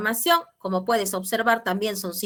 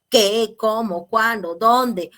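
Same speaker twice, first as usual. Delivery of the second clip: ¿Qué, cómo, cuándo, dónde? spoken slowly and loud, one by one; slow listed question words; 6 dB louder, much slower than usual. Shown as a demonstration. Teacher listing question words slowly for the class. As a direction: lay out each question word slowly and clearly. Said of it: "por ejemplo".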